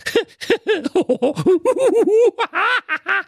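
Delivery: long, high-pitched tittering; high giggling. Acted. She-hedgehog tickled while being prepared, helpless high giggle. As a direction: burst into squeaky giggles. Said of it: "Was gibt's denn da zu kichern?".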